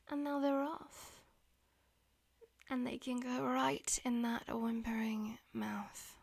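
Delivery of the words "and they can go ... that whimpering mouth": low teasing whisper. Acted. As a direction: whisper it close to the ear. Whispered throughout.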